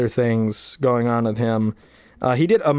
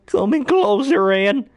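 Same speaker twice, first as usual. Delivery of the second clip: much higher, slow, melodramatic dying gasp; overacted death scene; comic fake death speech to Ann, hammed up; stupid fake death; 10 semitones higher, 4 dB louder, slower than usual. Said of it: "the stupid, um, like, fake death".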